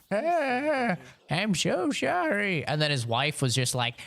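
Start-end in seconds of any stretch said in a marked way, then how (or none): 0.1-2.6 put-on old man apologizing. Old man apologizing after crash.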